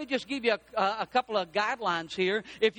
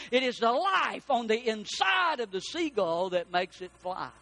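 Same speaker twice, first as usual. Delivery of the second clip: ordinary delivery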